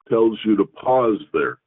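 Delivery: normal talking voice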